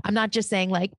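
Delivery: ordinary delivery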